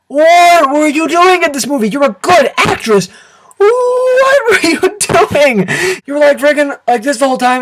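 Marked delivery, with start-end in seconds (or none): 3.6-5.7 exasperated drawn-out question. Real, not acted. Baffled at actress's performance.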